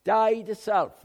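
high, loud preaching cry of the phrase; forceful shout. Real, not acted. Preacher hammers the point home to the congregation, shouting. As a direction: drive it home at full voice.

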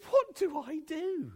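high, loud imitated tearful plea; mock a drawn-out, crying question. Andy tearfully asking the preacher what to do, imitated crying. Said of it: "and he's crying".

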